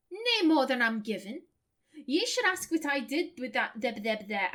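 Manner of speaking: game character voiced in broad Lancashire; broad Lancashire accent. Isilmir answers what she takes from him, broad Lancashire accent. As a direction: answer in a northern English, Lancashire burr. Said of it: "I'm not doing a Scottish accent, I'm doing my natural accent".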